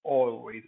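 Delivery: always said slowly and clearly as a model; pronunciation demo; demo word. Shown as a demonstration. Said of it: "So this also".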